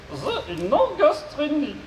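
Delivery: high, slow robot voice with garbled consonants. Acted. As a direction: mangle the consonants like a glitchy robot. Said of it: "souffre d'une pathologie vocale".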